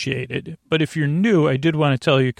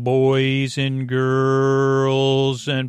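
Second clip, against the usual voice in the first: slow, drawn-out announcer intro; slower than usual. Pretend. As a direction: stretch it like a ringside announcer. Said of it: "uh, announcer voice".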